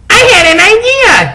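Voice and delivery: very high eager cry; excited exclaim. Acted. Vampire exclaiming his plan, thrilled. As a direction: burst out with it. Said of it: "he exclaimed".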